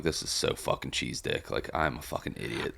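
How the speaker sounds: whispered self-mockery. Quoting himself at the movie.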